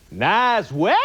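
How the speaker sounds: put-on "Nice weather!" squashed to "næz we" in a Maine accent; mock Maine accent. Comedian mocking how people in Maine talk, Maine accent. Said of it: "this crazy accent in Maine".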